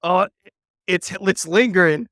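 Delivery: about to sneeze, fighting it; holding back sneeze. Real, not acted. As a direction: talk through an incoming sneeze. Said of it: "I'm about to sneeze".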